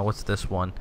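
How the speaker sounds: usual speaking voice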